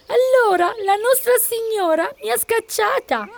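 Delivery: very high-pitched, plaintive cat voice; whining cat. A narrator's reading. Old cat explains her eviction to the donkey, whining. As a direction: whimper it out as a pitiful cat. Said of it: "piagnucolò la gatta".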